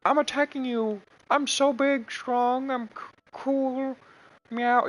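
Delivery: high, puffed-up little cat voice; boastful kitten voice. Pretend. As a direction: brag like a tiny cat who thinks he's huge. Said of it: "and, like".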